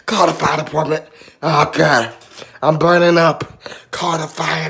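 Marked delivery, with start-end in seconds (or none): none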